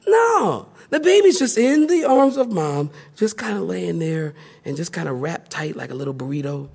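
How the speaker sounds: ordinary talk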